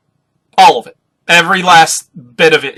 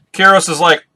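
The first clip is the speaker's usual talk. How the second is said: ordinary talk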